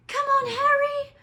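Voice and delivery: very high, loud cheering scream. A narrator's reading. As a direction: scream for Harry to win. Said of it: "Hermione screamed".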